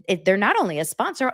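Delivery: ordinary talk, nothing marked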